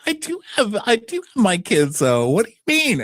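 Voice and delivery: high falsetto brush-off; breezy mock denial; high dismissive voice. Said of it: "She's like".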